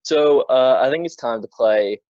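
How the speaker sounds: ordinary delivery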